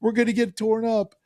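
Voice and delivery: very high, mock-panicked voice; hammed-up high-pitched alarm; imitating freaked-out tourists. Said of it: "the tourists were freaking out".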